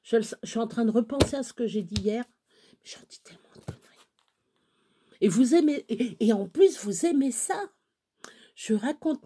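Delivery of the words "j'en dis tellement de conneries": aparté chuchoté, rapide. Real, not acted. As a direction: murmure vite pour soi-même. Aparté sur ses propres bêtises. (chuchotement rapide en aparté)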